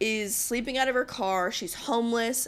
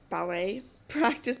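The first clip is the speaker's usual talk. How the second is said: ordinary delivery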